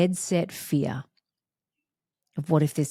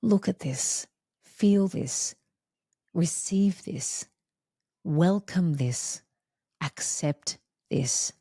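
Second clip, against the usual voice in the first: tender loving voice; slower than usual. Retold or imitated. The experience speaking tenderly to her ego. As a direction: gently invite her to receive. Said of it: "obliterated my tiny little ego self and said".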